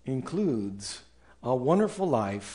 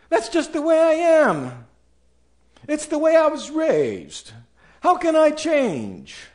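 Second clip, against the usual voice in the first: much higher, louder plaintive whine; whiny objection; 11 semitones higher, 4 dB louder than usual. Acted. A listener objecting to the pastor, plaintive whine. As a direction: plead helplessly. Congregant's excuse voiced.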